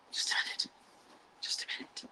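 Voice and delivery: soft whisper to herself. Real, not acted. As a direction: mutter it under her breath. Repeated to self while working.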